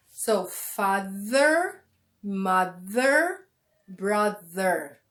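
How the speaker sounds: father mother brother read slowly; slow careful model of the family words; slow demo reading. Shown as a demonstration. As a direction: stretch each th word out. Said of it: "We have father".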